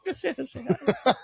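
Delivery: laughing while repeating the words. Real, not acted. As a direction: laugh through the quoted line.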